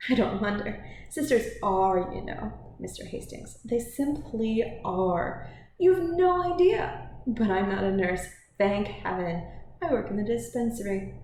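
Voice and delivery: put-on animated young woman, drawing out key words; emphatic Cynthia. Voices Cynthia on hospital sisters.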